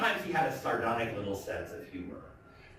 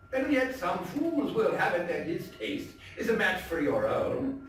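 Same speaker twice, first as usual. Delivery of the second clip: dramatic, theatrical storytelling voice; story narration; 7 semitones higher than usual. A narrator's reading. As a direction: read it like a gothic tale told by the fireside. Dramatic reading of Poe story.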